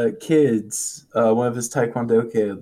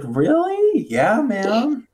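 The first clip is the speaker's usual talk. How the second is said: put-on high excited kid voice; lightly hammed kid; 12 semitones higher, much slower than usual. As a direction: squeak it like an eager little kid. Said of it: "He's like".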